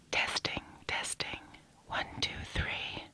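unvoiced, slow whisper; whispered mic check. Daughter whispering a mock mic check.

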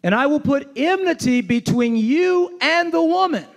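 preacher lifting the verse high; climactic preaching. Acted. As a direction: proclaim it as the turning point.

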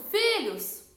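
high, loud chorus answer; congregation's shout. Retold or imitated. Whole church replying to the pastor, imitated shout. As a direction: imitate a whole crowd calling out.

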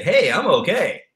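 imitating a cheery I'm okay; upbeat okay. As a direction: sell the okay with pep. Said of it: "Yeah, you say".

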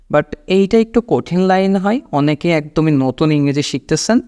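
usual speaking voice

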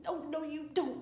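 lightly acted wifely scolding; firm refusal. A narrator's reading. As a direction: put your foot down with a sleeper. Stopping him going back to sleep.

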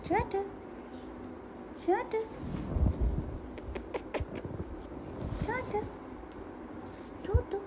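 high cooing call to a pet; high-pitched pet-calling coo; high sing-song baby talk. Acted. Coaxing pet parrot to talk.

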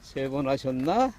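normal talking voice

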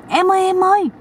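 mock panicked shriek to the brother-in-law; high cry out. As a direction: cry out in alarm. Sister-in-law cries out in the boat.

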